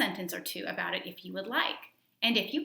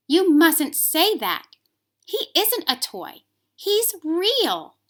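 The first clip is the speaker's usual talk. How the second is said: put-on higher, louder, slow child's voice; pleading little boy; 10 semitones higher, 10 dB louder, much slower than usual. A narrator's reading. The boy in bed reaches for his bunny, high childlike plea. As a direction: demand it like an upset small boy. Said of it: "he said".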